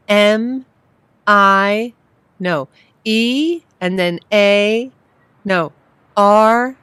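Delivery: each letter called out loud and high; loud careful spelling. Acted. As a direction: spell it out slowly, loud and unsure. Spelling hard street name.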